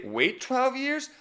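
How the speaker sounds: high, slow rising question on "twelve"; questioning imitation. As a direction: mimic her unsure questioning tone on "Wait twelve years?". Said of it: "So, so you say".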